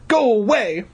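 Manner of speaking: high frantic shout; frantic scream. Acted. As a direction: scream at the thing to leave. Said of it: "I screamed frantically".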